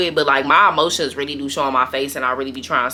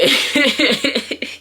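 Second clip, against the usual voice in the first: laugh; 8 semitones higher than usual. Real, not acted. Laughing at own remark.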